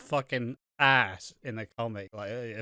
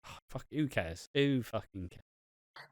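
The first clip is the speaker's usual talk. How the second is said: bored muttering; 2 semitones lower, 7 dB quieter, slower than usual. Retold or imitated. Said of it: "It's like".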